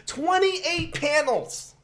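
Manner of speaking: very high, drawn-out squeal of disbelief; incredulous high-pitched wail at normal volume; appalled disbelief. Real, not acted. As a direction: stretch it out slowly, voice shooting way up. Appalled at the final panel count.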